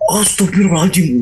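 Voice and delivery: high-pitched startled oath; retold fright. Man seeing weeping figure at window, retold fright. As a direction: blurt it out high, as if just seeing it.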